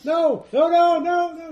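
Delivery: high loud frantic no's; panicked screaming. Acted. Captive being dragged behind trees, panicked screaming.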